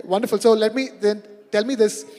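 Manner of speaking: ordinary talk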